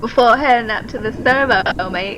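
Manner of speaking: English-sounding Aussie attempt. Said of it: "she's come from London".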